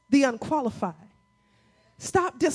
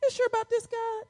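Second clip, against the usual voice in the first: high, soft, tentative question; hesitant doubt; 11 semitones higher, 4 dB quieter than usual. Retold or imitated. Preacher questioning God's word, high and doubtful. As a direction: query it timidly in a raised pitch. His doubting response to God.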